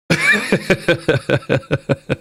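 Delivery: long, high run of giggles; helpless laughter. Real, not acted. Streamer laughing at roadman truck pun, helpless giggles. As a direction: laugh helplessly for a couple of seconds.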